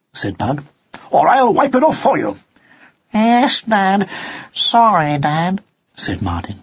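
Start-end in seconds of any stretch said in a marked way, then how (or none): 1.1-2.4 raised-pitch fatherly threat; mock-stern warning; threatening father. A narrator's reading. Father cat threatens his son. 3.1-5.6 put-on high, apologetic young cat; high-pitched sheepish apology; meek son. A narrator's reading. Son cat apologises after a scolding.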